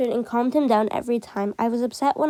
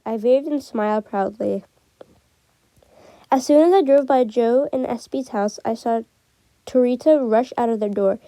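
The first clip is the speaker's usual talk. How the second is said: usual speaking voice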